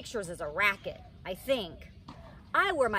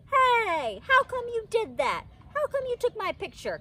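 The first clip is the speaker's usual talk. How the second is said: high, loud, outraged squeal; shocked that he snapped her; indignant protest; 13 semitones higher, 5 dB louder than usual. A narrator's reading. Child protesting sudden photo.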